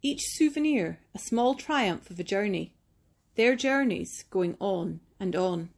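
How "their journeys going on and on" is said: usual speaking voice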